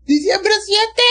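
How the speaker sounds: very high squeal of excitement; excited scream. Real, not acted. Fan repeating the release date, very high excited scream. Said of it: "de tanto que gritas".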